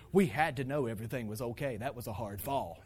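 low, composed explanation; acted level-headed mother, pitched low; calm, matter-of-fact wife. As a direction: explain it unruffled. Voicing calm wife after panic.

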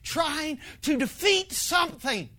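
desperate tired plea; pleading prayer. Retold or imitated. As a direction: plead with God for breathing room. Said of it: "this was the terminology that I used".

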